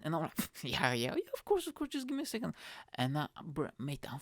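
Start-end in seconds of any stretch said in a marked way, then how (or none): 0.6-2.5 high, squeaky nervous agreement; badly faked enthusiasm, pitched up; feigned eagerness. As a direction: stall in a high, falsely keen voice. Faking eagerness while nervous.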